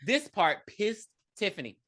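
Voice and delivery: usual speaking voice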